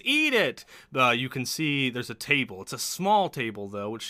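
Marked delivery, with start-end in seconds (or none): 0.1-0.6 high, loud imitation of Shmee; door-burst yell. Shmee swinging the guild door open, high mock yell. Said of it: "swings the door open and says".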